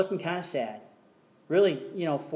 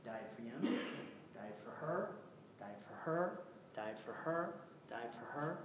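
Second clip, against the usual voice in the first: soft, low died for her, rising each time; God quietly naming each one he died for; hushed; 8 semitones lower, 19 dB quieter than usual. Retold or imitated. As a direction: murmur it gently, much softer. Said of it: "And then he said".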